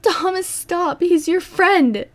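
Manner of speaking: very high screamed plea; panicked scream. A narrator's reading. Anna tries to pull Thomas off Peter, screaming. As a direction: scream at him to stop. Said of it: "Anna screamed, trying to pull him off".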